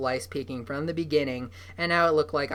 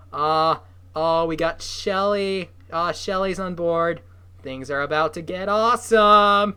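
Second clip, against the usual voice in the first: loud, slow, gleeful aside; excited; 3 semitones higher, 8 dB louder, slower than usual. Real, not acted. Reader gushing to listeners about Shelley joining, loud and excited. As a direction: cheer for the new ally.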